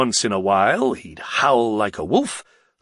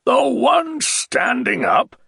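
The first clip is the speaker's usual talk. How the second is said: raised, slow and scornful; the Baron mocking the girls, loud; 13 semitones higher, 4 dB louder, slower than usual. A narrator's reading. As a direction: announce it loudly to the room. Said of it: "he said loudly".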